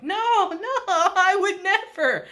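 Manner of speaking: hammed-up squeal of false innocence; mock innocent denial. Kushida playing off an accusation, high mock-innocent denial. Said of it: "Kushida plays it off like".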